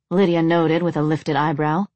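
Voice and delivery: ordinary delivery, nothing marked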